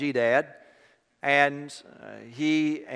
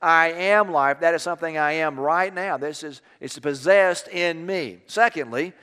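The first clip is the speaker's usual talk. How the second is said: raised, louder preaching voice with growing fervour; preaching sermon; 4 semitones higher, 6 dB louder than usual. Acted. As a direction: preach it out to the congregation, building intensity. Preacher building to full force.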